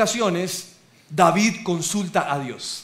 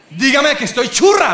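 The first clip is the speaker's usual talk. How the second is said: higher, louder teasing demand through a laugh; imitating wife; 6 semitones higher, 7 dB louder than usual. Preacher's wife asking him to call her pretty, loud and laughing. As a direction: play the wife fishing for a compliment. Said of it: "mi esposa dice".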